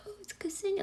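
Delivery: says her own name barely audibly, embarrassed; tiny timid voice; shy near-whisper. Retold or imitated. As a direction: mumble her name so no one hears it. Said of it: "I would say it really quietly".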